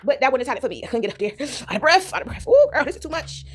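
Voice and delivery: ordinary delivery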